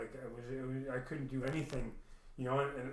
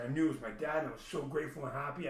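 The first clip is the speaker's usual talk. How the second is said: ordinary talk, nothing marked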